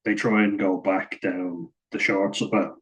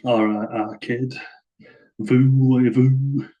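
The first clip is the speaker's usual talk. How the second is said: mock Wigan accent mangling French, higher and louder; hammed-up Greater Manchester take on French words; Shaun Edwards in French; 5 semitones higher, 4 dB louder, slower than usual. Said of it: "I imagine Shaun Edwards with the Wigan accent".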